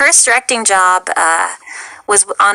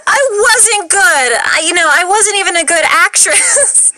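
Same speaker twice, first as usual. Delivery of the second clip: laughing; 9 semitones higher than usual. Real, not acted. Laughing self-deprecation.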